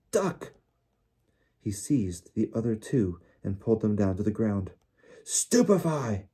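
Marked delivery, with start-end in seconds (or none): 0.1-0.5 sudden high-pitched yell; yelled warning. A narrator's reading. Boy warning his friends of attack, yelling. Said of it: "he yelled". 5.2-6.3 high, drawn-out spell cry; roared spell. A narrator's reading. Twenty wizards firing a stunning spell, roaring. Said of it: "roared twenty voices".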